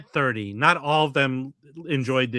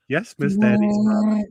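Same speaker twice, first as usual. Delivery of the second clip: put-on voice, higher and louder; a mock deferential servant voice; mock role line; 6 semitones higher, 4 dB louder than usual. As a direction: play the obsequious servant. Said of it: "say to him".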